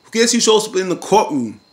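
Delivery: high, loud teasing question; dramatic reveal. Real, not acted. Recapper springs the twist on viewers, dramatic reveal.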